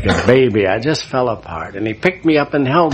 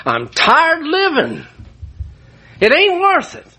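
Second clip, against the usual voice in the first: despairing cry. Retold or imitated. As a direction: cry out that life is not worth it. Retells suicidal despair.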